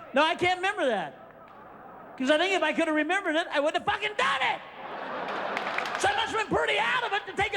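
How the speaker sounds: high, shouted sarcastic protest; sarcastic denial. Retold or imitated. Arguing with his friends, high sarcastic shouting.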